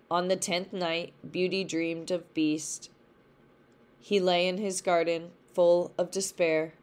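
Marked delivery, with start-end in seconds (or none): none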